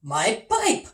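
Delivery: cried out. Acted. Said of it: "cried the captain".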